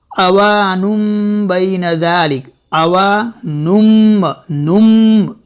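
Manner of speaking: slow, stressed नुम with a clear m; m sound. Shown as a demonstration. Quran teacher stresses the m sound for students. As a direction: stretch अवानुम and land hard on the final m. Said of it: "ये मीम की आवाज़ आई".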